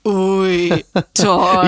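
low, sneering, condescending drawl; condescending Scar voice. Retold or imitated. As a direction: sneer it like a smug lion villain. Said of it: "herablassende Stimme von Onkel Scar aus König der Löwen".

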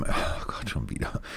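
whispered, low grumble; breathy muttered annoyance under the breath; exasperated aside. Real, not acted. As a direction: break off and whisper it to yourself. Interrupts himself while reading his note.

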